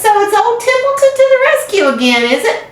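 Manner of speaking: high sneering mimicry of the others; disgusted rat mocking. A narrator's reading. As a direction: whine out their demands sarcastically. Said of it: "he said in disgust".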